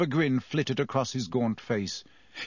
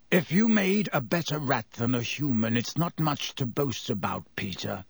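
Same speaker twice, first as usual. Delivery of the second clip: ordinary delivery, nothing marked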